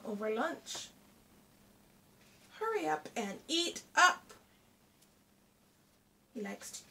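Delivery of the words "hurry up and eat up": higher, louder nagging in a put-on voice; impatient Hare voice. Hare nagging Tortoise over lunch, impatient put-on voice. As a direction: pester him to finish lunch.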